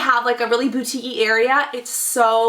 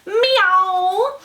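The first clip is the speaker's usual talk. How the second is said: playful high meow, a cat's voice; sing-song kitty meow; cutesy cat meow; 7 semitones higher, 3 dB louder than usual. Pretend. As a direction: mew it like a kitten. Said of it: "and it says".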